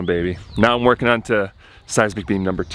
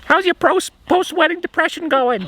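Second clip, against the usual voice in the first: much higher, imitating his mom's Worcester accent. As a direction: mimic a mother from Worcester, Massachusetts. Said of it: "she's got the Worcester accent".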